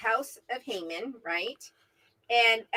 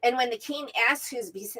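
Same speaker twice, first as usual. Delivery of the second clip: normal talking voice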